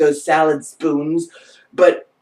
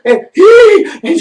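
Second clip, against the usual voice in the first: sudden high, loud squeal; imitated squeal; 13 semitones higher, 9 dB louder, slower than usual. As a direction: squeal out mid-sentence, mimicking someone jolted. Imitates coworker's involuntary squeal.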